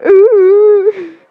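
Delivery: high loud dog howl. Acted. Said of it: "So dog like".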